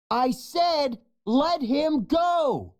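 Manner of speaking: high, slow insistent yell; repeated shout. A narrator's reading. Young lion shouting again at laughing hyenas. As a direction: yell it harder the second time. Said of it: "Kion shouts".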